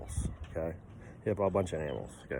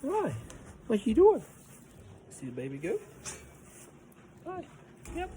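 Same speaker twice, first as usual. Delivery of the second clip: high pet voice; 12 semitones higher, 7 dB quieter than usual. Real, not acted. Greeting the farm cats, in a high cooing pet voice. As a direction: coo up high like talking to a kitten.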